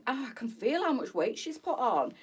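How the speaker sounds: normal talking voice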